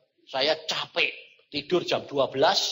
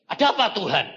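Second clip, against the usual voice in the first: louder, higher jolted-awake answer; startled reply; 5 semitones higher, 8 dB louder, faster than usual. Retold or imitated.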